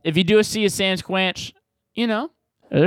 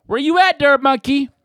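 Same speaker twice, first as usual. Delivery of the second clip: calling out; 9 semitones higher, 6 dB louder than usual. Real, not acted. Calling out to absent friend.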